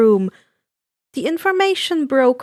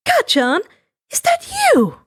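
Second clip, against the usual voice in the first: sudden high, cracking cry of disbelief; tearful; 14 semitones higher, 3 dB louder than usual. Acted. Inko recognising the broker, tearful and breaking high. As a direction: voice breaking as if she's seen a ghost. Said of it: "tears gathering".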